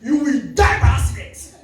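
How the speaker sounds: furious woman yelling a curse; lady in the phone booth shouting a curse; angry shout. Retold or imitated. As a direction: scream the curse at him.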